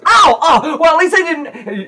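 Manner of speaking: loud, very high yelp; yelp, then argue back like a child; slapped kid protesting. Acted.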